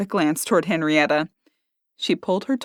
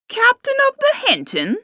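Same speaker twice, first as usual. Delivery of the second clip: much higher, incredulous question; mocking disbelief. A narrator's reading. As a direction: scoff in disbelief.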